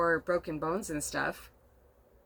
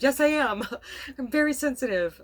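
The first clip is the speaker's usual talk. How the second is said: laughing through tears; 7 semitones higher, 7 dB louder than usual. Real, not acted. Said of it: "I haven't been able to stop crying".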